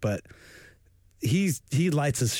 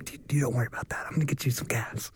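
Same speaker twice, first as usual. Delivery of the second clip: whisper; 4 dB quieter, faster than usual. Pretend. Thief whisper.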